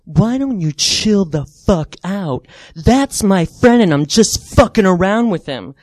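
loud, slow, menacing retort; angry comeback. Retold or imitated. Bully in her face saying they're friends, loud mock-angry retort. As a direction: lean hard on each word, mock aggression.

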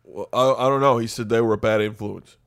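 Eli Manning voice. Acted. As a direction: play a sheltered shy son.

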